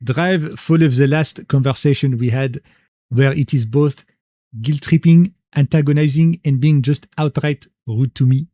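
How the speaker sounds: slow, lightly put-on French accent. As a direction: read the line like a hammy Frenchman, unhurried. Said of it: "I'll read this sentence right here in a French accent".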